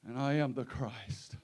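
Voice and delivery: low, solemn declaration; hushed proclamation. Real, not acted. Preacher declaring who the Lord is, low and hushed after the climax. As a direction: drop to a hush with long pauses.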